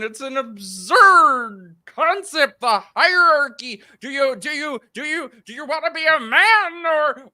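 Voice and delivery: mock pop psychologist pleading; Jordan Peterson impression. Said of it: "very solid Jordan Peterson impersonation".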